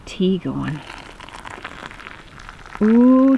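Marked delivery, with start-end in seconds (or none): 2.8-3.4 delighted. Real, not acted. Pleased by the chai.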